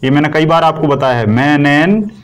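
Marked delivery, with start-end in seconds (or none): 1.3-2.2 "meningitis" broken up with pauses between parts; dictation. Shown as a demonstration. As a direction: sound out "meningitis" piece by piece for writing. Teacher spells out term while writing.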